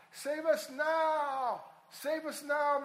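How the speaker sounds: loud, high, slow plea "Save us now"; pleading cry. Shown as a demonstration. Preacher crying out the meaning of Hosanna, loud and pleading. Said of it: "Hosanna means save us now".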